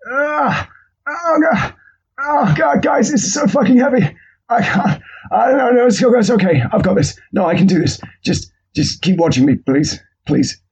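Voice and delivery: high strained grunting gym lifter; straining meathead. Retold or imitated. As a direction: ham up a lifter groaning under the weight.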